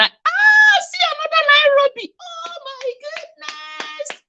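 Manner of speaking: high squealing delight, sped up; excited squeal. Real, not acted. Host thrilled that a viewer from Nairobi joined, high excited squeal.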